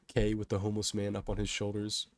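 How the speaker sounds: ordinary delivery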